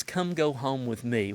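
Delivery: usual speaking voice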